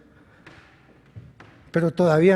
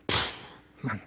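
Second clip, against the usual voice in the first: sorrowful; 4 semitones lower, 7 dB quieter than usual. Real, not acted. Husband pained by his wife's tears, softly sorrowful. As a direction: speak as if it hurts to say. Grief over wife's crying.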